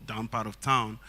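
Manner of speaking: normal talking voice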